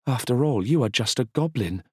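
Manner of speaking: haughty high sneer; sneering queen. Acted. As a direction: belittle him from above.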